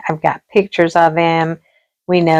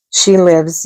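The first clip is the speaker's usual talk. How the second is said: normal talking voice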